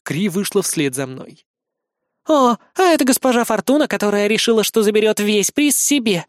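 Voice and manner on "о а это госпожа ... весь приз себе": higher-voiced mild scoff; snorting sarcasm. A narrator's reading. Azumi hanging in his arms, mocking scoff. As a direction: scoff lightly in a girl's voice. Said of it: "хмыкнула висящая Азуми".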